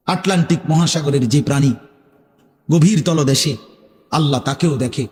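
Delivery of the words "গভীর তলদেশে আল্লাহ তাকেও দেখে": usual speaking voice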